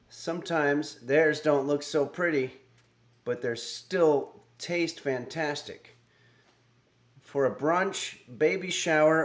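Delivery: recipe intro read out in measured phrases; projected, deliberate read with pauses between phrases; scripted cooking-show presenter. Acted. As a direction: present the dish like a TV cook reading copy.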